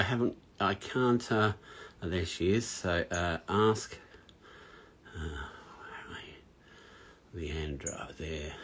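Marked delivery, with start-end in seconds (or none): 5.1-8.5 very quiet, low, slow murmur; half-whispered, absent-minded self-talk; muttering to himself. Real, not acted. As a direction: talk under your breath while searching. Searching screen to invite guest.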